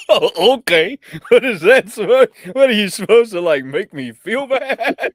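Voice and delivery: high, loud through laughter; laughing. Retold or imitated. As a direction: laugh your way through the words. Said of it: "And I went".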